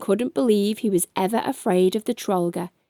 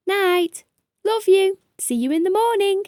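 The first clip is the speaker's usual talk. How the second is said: put-on high, fast sleepy child voice; 10 semitones higher, 3 dB louder than usual. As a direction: chirp goodnight to dad.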